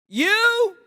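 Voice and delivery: high, drawn-out questioning call; long high holler; calling across. Acted. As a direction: call the question out over a distance. Calling across the dark to strangers.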